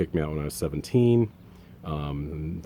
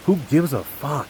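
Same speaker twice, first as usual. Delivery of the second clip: pissed off; 7 semitones higher, 4 dB louder than usual. Retold or imitated. Son retelling what he told his father, pissed off. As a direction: hit it harder, openly fed up. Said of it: "I was pissed".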